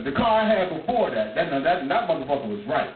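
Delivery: ordinary delivery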